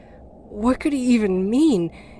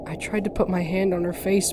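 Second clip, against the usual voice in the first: usual speaking voice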